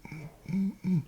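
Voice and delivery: closed-mouth "mm" noises; short mock straining mumbles; imitated muffled grunts. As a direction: mimic struggling to eat with muffled hums. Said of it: "he was going like this".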